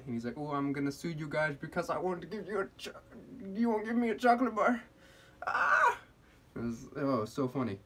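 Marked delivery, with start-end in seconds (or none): 0.4-4.8 rising high, hammed-up tantrum voice; imitating an outraged customer; mock yelling customer. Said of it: "he was still yelling at us". 5.5-6.0 loud falsetto shriek; very high hammed-up scream; mock yelling scream. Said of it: "he was still yelling at us".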